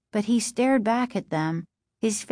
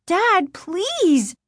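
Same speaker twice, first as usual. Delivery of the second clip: high, pleading cry to her father; scared daughter begs her dad; frightened plea; 11 semitones higher than usual. Acted. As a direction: beg him to stop, voice up high. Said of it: "Margaret cried".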